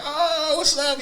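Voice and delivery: high, loud surprised hey; excited greeting. Retold or imitated. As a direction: greet a friend you didn't expect, bright and loud.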